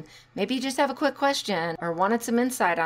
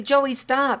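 habitual yell; 6 semitones higher, 4 dB louder than usual. Shown as a demonstration. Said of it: "If you are always yelling".

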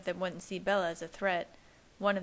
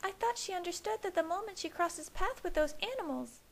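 put-on much higher, worried young woman; Alice voice; 11 semitones higher than usual. A narrator's reading. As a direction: voice the anxious sister.

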